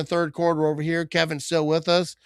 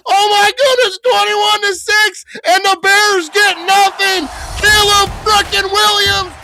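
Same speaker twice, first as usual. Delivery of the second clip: much higher and louder, yelling the interception; ecstatic shout; 13 semitones higher, 11 dB louder than usual. Real, not acted. Packers fan celebrates the Bears' interception, ecstatic shouting. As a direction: scream it like your team just won.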